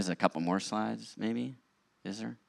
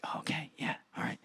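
sheepish; 7 semitones higher, 7 dB quieter than usual. Retold or imitated. Sheepish reply to friend.

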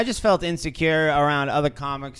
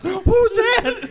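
very high, slow, incredulous squeal; mock shocked reaction; 5 dB louder, much slower than usual. Imitating bandmate who looked over at him, mock shocked disbelief. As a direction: imitate someone aghast, pitched way up.

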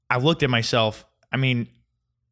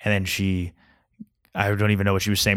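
ordinary delivery

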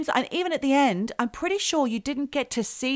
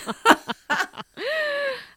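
high laugh. Real, not acted. Laughing at apology.

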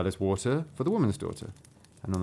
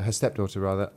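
ordinary delivery

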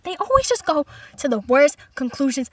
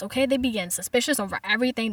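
ordinary talk

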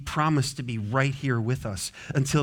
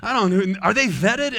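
put-on scared toddler; 5 semitones higher, 6 dB louder than usual. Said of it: "you could see the terror".

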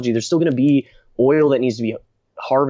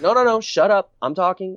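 mock impatient interruption, pitched much higher; high, insistent imitation of shutting someone down; retold cutting-off; 8 semitones higher than usual. Scientist silences the fawning anchor, mock impatient rebuff. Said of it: "He's like".

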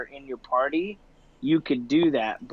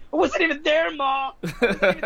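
put-on much higher and louder kid voice insisting; kid protesting to mom, louder; 11 semitones higher, 4 dB louder, faster than usual. Gamer kid keeps yelling to his mom, higher and louder. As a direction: shout it at mom like a caught teen.

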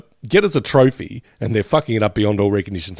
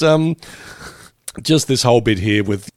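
usual speaking voice

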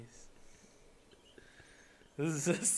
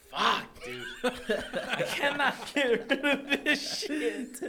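laughing with hiccups; 8 semitones higher, slower than usual. Real, not acted. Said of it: "Hold your breath".

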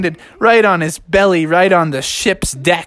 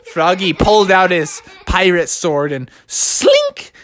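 ordinary delivery, nothing marked

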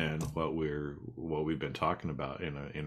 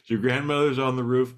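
higher, louder amused echo; amused repeat; 7 semitones higher, 6 dB louder than usual. Real, not acted. Host repeating the joke line, higher amused echo. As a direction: savour the punchline.

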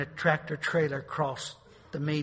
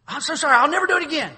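high, loud, fast mock-pleading sinner; mock wailing apology; 12 semitones higher, 12 dB louder, faster than usual. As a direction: ham up a desperate false apology. Mock fake repentance wailing.

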